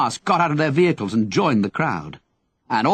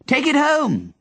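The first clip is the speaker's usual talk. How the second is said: shout; 13 semitones higher, slower than usual. Acted. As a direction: shout it down, much higher. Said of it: "Henry shouted".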